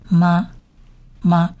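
"妈" as m plus a short a, on its own; ma syllable demonstrated. Shown as a demonstration. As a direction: repeat "妈" as an isolated syllable with pauses. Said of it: "它是发成么的音". (分开示范“妈”，m 加短“啊”音)

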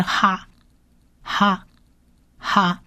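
"哈" repeated softly, clearly; syllable demo. Shown as a demonstration. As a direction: sound out "哈" for learners. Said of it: "短母音啊的音". (轻声示范哈)

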